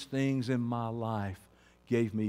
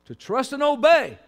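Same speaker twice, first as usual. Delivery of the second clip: loud, high pulpit cry; sudden full-force exhortation; impassioned preaching; 11 semitones higher, 12 dB louder than usual. Real, not acted. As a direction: shout it out as the key point.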